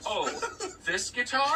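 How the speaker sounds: high, loud fake surprise; mock coyness. Guitar kid pretending to be surprised, high and coy. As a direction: play innocent show-off.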